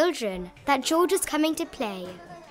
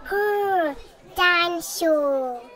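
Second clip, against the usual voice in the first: slow, high little growl; toddler dinosaur growl; 5 semitones higher, 3 dB louder, much slower than usual. A narrator's reading. Little brother growling at the class, slow and high. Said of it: "shakes his toy Mister Dinosaur".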